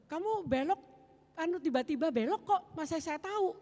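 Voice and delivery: high, loud protest, retold; indignant retort. Woman answers the driver blaming her, indignantly.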